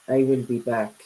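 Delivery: low, deadpan movie-robot catchphrase; Terminator. Retold or imitated. Streamer teasing a viewer with a film catchphrase, Terminator impression. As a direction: lightly imitate the Terminator's low delivery. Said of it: "Like the Terminator".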